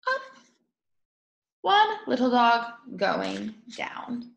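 plain reading voice

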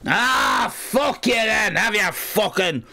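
high, drawn-out mock outburst; exasperated acted yell; angry retort. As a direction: act out snapping at a whining child. Retold angry reply to child.

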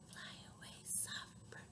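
barely audible whisper; soft. Shown as a demonstration. Sending the soft bird away, tiny whisper. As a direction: whisper it as gently as possible. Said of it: "One named Soft".

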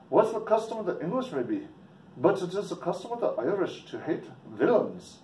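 high, lilting mock Irish brogue; Irish accent. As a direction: hostile local in an amateur Irish accent. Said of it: "Sorry about my English or Irish accent".

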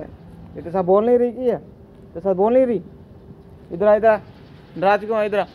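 high, loud mock scolding of a monkey; high teasing scold; high scolding pet talk. Real, not acted. Coaxing a sulking monkey.

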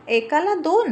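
baffled question. A narrator's reading. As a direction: asks again, now truly baffled. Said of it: "तो सेवक म्हणाला".

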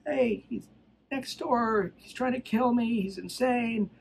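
high-pitched imitation of a frantic woman; retold cry for help, pitched well up; mock screaming woman. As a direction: act out her alarm without really screaming. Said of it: "comes out of the house screaming".

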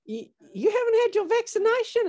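light mock of a niece nagging; imitating niece telling off her nan; niece scolding. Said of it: "my niece says to her".

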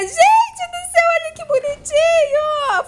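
high, drawn-out shocked cry; shocked shout. Real, not acted. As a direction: shriek it in disbelief. Shocked at turning into chicken.

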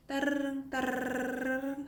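high, sustained rolled r in "따르릉"; long monotone trill on "따르릉"; trill practice. Shown as a demonstration. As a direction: ring out "따르릉" like a phone bell. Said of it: "요 느낌으로 연습을".

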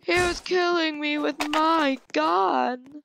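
very high, drawn-out whine; high-pitched, stretched gamer lament; wailing protest. Real, not acted. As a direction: wail in outrage at your own bad luck. Killed with own gun, wailing.

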